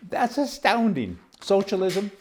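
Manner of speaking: high, slow indignant; incredulous outrage. Real, not acted. Outrage at surgeons.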